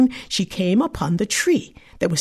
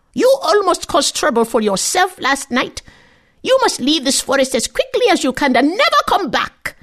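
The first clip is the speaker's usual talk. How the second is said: higher crone voice, firm; stern warning old woman; 7 semitones higher than usual. Acted. The old woman warning the girl to leave, firm. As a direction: send the girl away firmly.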